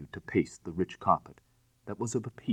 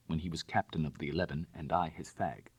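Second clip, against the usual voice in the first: plain reading voice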